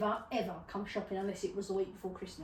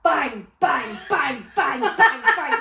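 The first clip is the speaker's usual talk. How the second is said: loud, punched repeated bangs; booming sound-effect words, each one falling; imitated crashing; 3 semitones higher, 12 dB louder, slower than usual. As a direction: fire off each bang like a falling domino. Imitating bollards crashing down in sequence.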